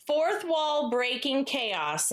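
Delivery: usual speaking voice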